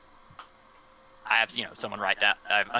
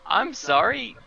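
higher, stunned apology; disbelief; 11 semitones higher, 4 dB louder, slower than usual. Acted. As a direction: say it as if you misheard. Reacting to teammates in space.

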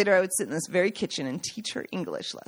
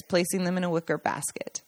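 plain reading voice

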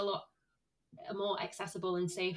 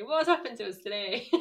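words broken by giggles; laughing through the question; 4 semitones higher, 6 dB louder than usual. Real, not acted. Giggling fit.

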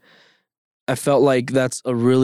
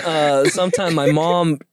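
ordinary talk, nothing marked

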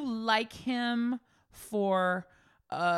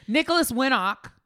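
normal talking voice

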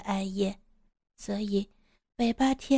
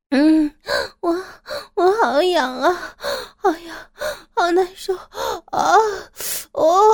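urgent moaning; 9 semitones higher, 5 dB louder than usual. Acted. Narrator voices a woman's arousal, urgent moaning. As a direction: plead in high, urgent moans. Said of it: "开始急切地呻吟着".

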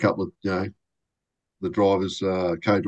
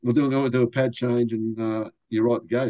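ordinary talk

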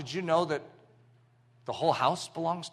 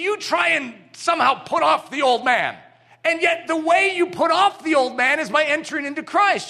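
louder, higher, building preacher's cry; preaching shout; 9 semitones higher, 6 dB louder than usual. Real, not acted. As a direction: drive the point home to the congregation.

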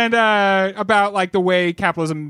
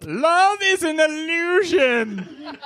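mock heartbreak; 10 semitones higher, much slower than usual. Mock despair at celebrity breakup.

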